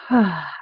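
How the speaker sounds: audible falling sigh; long releasing exhale. Shown as a demonstration. Teacher releasing the day's tension with listeners, long sighing exhale. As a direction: release the tension in a breathy "hah". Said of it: "in a long exhale".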